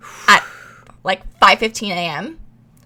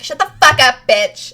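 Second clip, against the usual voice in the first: louder, snapping mock anger; angry retort; 4 semitones higher, 6 dB louder than usual. Angry friend snaps at teller trying to wake her, mock anger. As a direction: spit it like a rude comeback.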